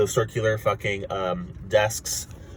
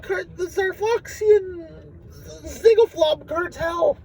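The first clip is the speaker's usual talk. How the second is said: very high, slow, squeaky alien voice; squeaky alien trader; much slower than usual. Retold or imitated. Alien trader shoving past, squeaky high voice. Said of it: "some guy pushes him away and says".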